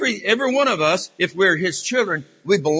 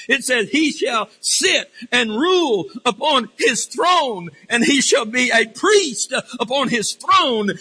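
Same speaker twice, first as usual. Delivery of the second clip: fervent preaching; 4 semitones higher than usual. Acted. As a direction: proclaim it to the congregation, stretching the key words. Preaches Christ enthroned as king.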